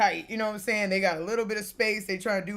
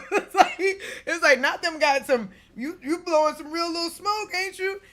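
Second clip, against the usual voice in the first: laughing tease; 7 semitones higher, 3 dB louder than usual. Real, not acted. Teasing the ride's dragon.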